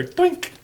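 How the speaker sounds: high squeaky sound effect; cartoon doink. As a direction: pop the digit over with a squeak. Sliding a digit over on board.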